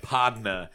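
"Podna" said as "PAHD-nuh", no R sounds; Southern "podna". Shown as a demonstration. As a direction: drawl "Podna" like a Texan. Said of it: "Podna" at the end of it".

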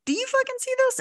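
high-pitched incredulous question; mock disbelief. Acts out startled attendant asking colleagues.

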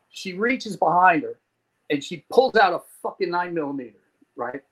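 usual speaking voice

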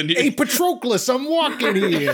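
put-on high, slow Brooklyn accent, laughing. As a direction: shout it in a thick Brooklyn accent. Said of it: "a Brooklyn accent".

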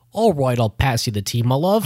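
put-on British accent, lifting at the end; exaggerated British tea-drinker; playful mock British lilt. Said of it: "person with a British accent".

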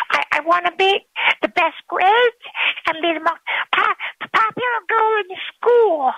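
put-on breathless little girl wishing. A narrator's reading. Girl makes third wish.

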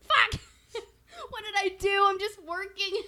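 half-laugh a panicked fuck. Retold or imitated. Friend retelling her panic about the silent call, laughing. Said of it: "I'm like".

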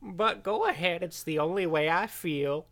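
put-on high, slow, plaintive old man. Retirement-home resident giving in to a beating, old-man voice. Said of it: "in this retirement home".

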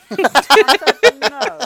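slow, loud, drawn-out no; mock firm refusal. Real, not acted. Friend refusing a jelly bath gift, loud and slow. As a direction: put your foot down, hammed up.

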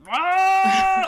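loud high whoa like a growling gut; stomach growl. Retold or imitated. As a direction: blurt it like a stomach noise. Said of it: "it's like".

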